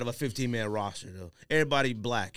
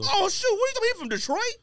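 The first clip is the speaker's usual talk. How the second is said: much higher and louder, caught off guard; surprised outburst; 4 dB louder, faster than usual. Real, not acted. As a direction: blurt it out in disbelief. Co-host guesses a wrong hometown.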